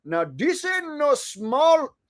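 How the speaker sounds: put-on jokey Indian accent read. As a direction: rap it in a hammed-up Indian accent. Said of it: "Indian accent to keep it comedy".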